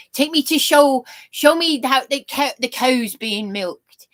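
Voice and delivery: broad country Sussex twang; Sussex farmer accent. Retold or imitated.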